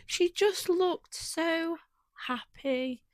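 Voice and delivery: high, slow, moved to tears; emotional. Real, not acted. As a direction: draw the words out, choked with emotion. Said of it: "makes me quite emotional".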